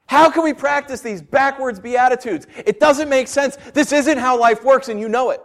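normal talking voice

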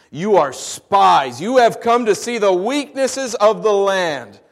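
loud, slow, stern charge; harsh accusation. Acted. Joseph accuses his brothers of spying, harsh and loud. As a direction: bear down on them like a suspicious ruler. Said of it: "spoke harshly to them".

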